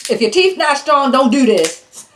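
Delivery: high, fast and loud, teasing the viewers; excited warning. Real, not acted. Warning viewers about weak teeth.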